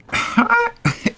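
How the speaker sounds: very high-pitched laugh of disbelief; incredulous laugh. Retold or imitated. Man stood up for lunch, incredulous high laugh. Reacting to her being on a date.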